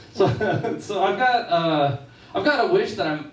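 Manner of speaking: ordinary talk, nothing marked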